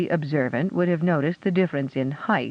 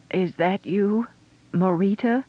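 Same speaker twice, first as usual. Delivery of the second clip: quavering; 5 semitones higher than usual. Acted. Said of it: "a quavering voice asked".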